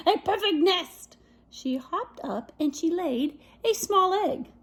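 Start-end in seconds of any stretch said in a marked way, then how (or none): 0.1-1.2 excited hen. Acted. Chicken admires nest.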